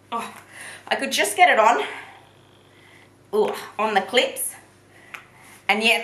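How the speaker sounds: straining to pull it tight; grunting with effort while dressing. Real, not acted. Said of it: "I'm, like, grunting and stuff 'cause that's how hard it is".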